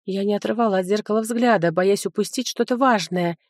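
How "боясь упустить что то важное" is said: ordinary delivery, nothing marked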